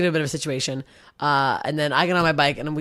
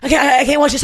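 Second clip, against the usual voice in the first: high, louder, overwhelmed plea; loud distressed protest; 9 semitones higher, 5 dB louder than usual. Retold or imitated. Comic on stage is too much, a loud distressed plea. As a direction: beg off loudly, overwhelmed. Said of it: "I'm like".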